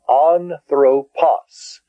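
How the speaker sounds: slow, separated An-thro-pos; syllable by syllable. Shown as a demonstration. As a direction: sound out Anthropos in three long beats. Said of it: "I divided that into syllables".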